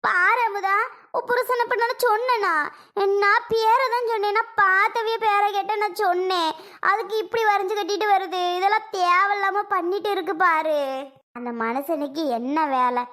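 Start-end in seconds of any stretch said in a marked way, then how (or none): 0.0-10.8 high-pitched, indignant self-defence; heated protest. Acted. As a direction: argue back hotly, pitch well up. Defends self in acted quarrel. (சண்டையில் கோபமாக, உயர்ந்த குரலில் மறுப்பு)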